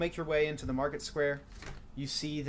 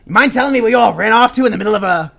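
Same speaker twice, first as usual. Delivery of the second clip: put-on high, loud grumpy old gnome scolding; a crotchety gnome hollering at the adventurers for showing up late; cranky old gnome yelling; 8 semitones higher, 15 dB louder, faster than usual. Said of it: "He goes".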